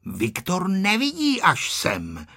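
objecting. A narrator's reading. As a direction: coax with a drawn-out counter-argument. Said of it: "namítl Ferda".

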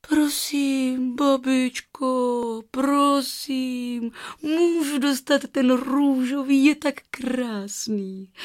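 put-on high, drawn-out child's begging; high girlish wheedling, long vowels; breathless pleading girl. A narrator's reading. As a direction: plead like a little girl wanting a treat. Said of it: "Ela vydechla".

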